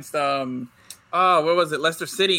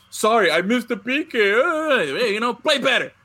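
higher-pitched sarcastic mock apology; mocking imitation; 5 semitones higher, 3 dB louder than usual. Host mocking Guardado's dirty tackle, sarcastic high voice. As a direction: taunt him in a put-on voice.

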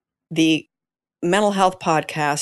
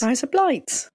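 higher-pitched Aussie mimicry; imitated Australian accent; 7 semitones higher, slower than usual. Host trying the co-host's phrase, Australian accent. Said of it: "Australian accent".

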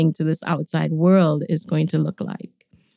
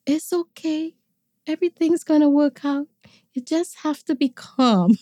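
imitating calm brother; 9 semitones higher than usual. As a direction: mimic him gently calming people down. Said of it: "would never get angry".

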